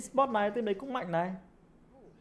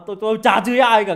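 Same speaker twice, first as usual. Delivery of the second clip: raised, loud, indignant denial; loud protest; 4 semitones higher, 11 dB louder than usual. Real, not acted. Streamer denying to his viewers that he swears, loud protest. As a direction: protest it at high volume.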